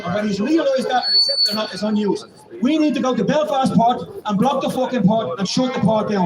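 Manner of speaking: ordinary delivery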